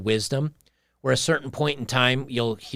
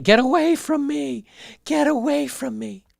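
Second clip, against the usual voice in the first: mock shrieking woman; 13 semitones higher than usual. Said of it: "Brian Young says".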